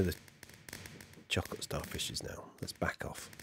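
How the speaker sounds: unvoiced, very soft whisper; whispering near the fireworks. Real, not acted. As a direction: back off in a hush.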